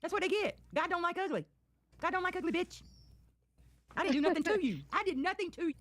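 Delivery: high, preachy jeer; gloating. Real, not acted. Streamer gloating that karma got her rivals, high preachy jeer. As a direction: lay it on with high righteous gloating.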